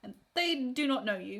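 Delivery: normal talking voice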